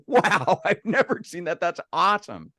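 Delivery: light up like an expert seeing a new star; amazed stargazer. Retold or imitated. Trained astronomer spotting a rare constellation, imitated delight. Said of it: "somebody who's trained would go".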